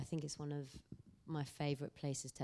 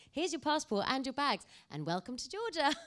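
lightly voiced host, higher and louder, beaming; cheery welcome; 9 semitones higher, 7 dB louder than usual. Airport welcome-lounge man greeting the arrival, beaming and loud.

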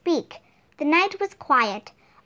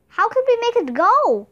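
desperate high shout; panicked question shouted at friends; cried out; 6 semitones higher than usual. A narrator's reading. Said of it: "cried Jack".

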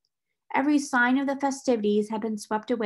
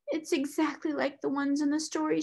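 high, plaintive lament; wailing; 6 semitones higher than usual. A narrator's reading. Maid weeping alone among the pots, wailing. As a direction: wail it in a raised, mournful voice. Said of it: "she wailed".

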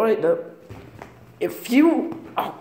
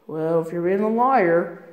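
usual speaking voice

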